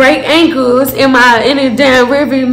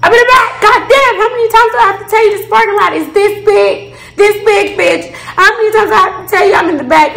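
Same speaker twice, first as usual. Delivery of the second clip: exasperated retort; 7 semitones higher than usual. Retold or imitated. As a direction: snap back at her, totally exasperated. Exasperated answer to friend.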